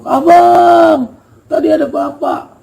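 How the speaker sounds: put-on crying girl. Bunga hugging him, crying.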